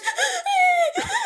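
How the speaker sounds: very high-pitched mock wail; exaggerated squealing cry; imitated bawling. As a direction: mimic a child bursting into sobs. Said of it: "And all I hear is".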